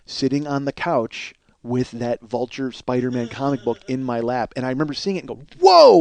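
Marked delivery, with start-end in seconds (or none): 5.6-6.0 high, loud burst of amazement; loud retold gasp of wonder; astonished whoa. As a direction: blurt it out, stunned and loud. Reliving a surprise at a photo.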